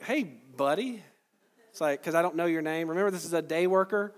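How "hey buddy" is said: slow, drawn-out Hey buddy to a stranger; knowing hey buddy. Shown as a demonstration. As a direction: greet a nameless worker with a pointed slow buddy. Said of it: "I do this sometimes".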